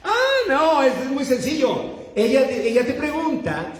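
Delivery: high and laughing, as if it were obvious; laughing know-it-all helper. Retold or imitated. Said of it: "como que trae ya el chévere".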